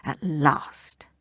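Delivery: breathy eager whisper. Acted. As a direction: whisper it to yourself, greedy.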